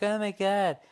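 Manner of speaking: mock incredulous outcry; mock disbelief. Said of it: "a lot of people are like".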